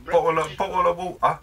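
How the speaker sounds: clipped British "bottle of water" with a dropped t; teasing British accent, bottle said "bo'le"; mock British accent. Mocking a teammate in a British accent.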